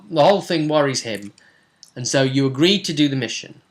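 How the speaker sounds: usual speaking voice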